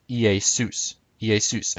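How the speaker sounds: IESUS as ee-ay-SOOS, stress last; biblical Greek pronunciation. Shown as a demonstration. Presenter teaching Jesus's name, biblical Greek pronunciation. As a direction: say Iesus with ay in the middle. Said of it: "in biblical Greek".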